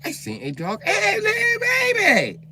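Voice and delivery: put-on very high, slow, loud child's voice; high little girl. A little girl begging to hold a baby, very high voice. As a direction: coo over and over like a stuck toy.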